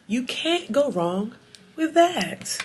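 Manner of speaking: slow, stretched, sassy; drawn-out sing-song. Pretend. Vlogger to viewers, turning the product praise into a slow sassy catchphrase. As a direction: lean into every word like a catchphrase.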